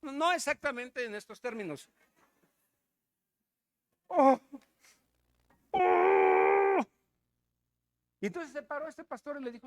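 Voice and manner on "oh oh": loud, drawn-out mock wail; pained wail. Preacher imitates woman who bit her tongue, pained wail. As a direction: moan loudly as if the tongue hurts.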